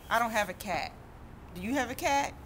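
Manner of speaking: plain reading voice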